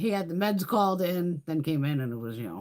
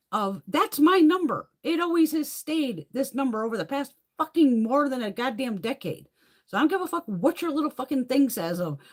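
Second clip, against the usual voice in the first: angry rant; 6 semitones higher, 3 dB louder than usual. Retold or imitated. Ranting at her doctor.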